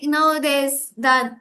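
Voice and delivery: usual speaking voice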